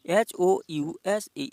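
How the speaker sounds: slow spelling of एच ओ यू एस ए for students. Shown as a demonstration. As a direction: spell out एच ओ यू एस ए very slowly, each letter apart. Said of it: "यानी house दिया हुआ".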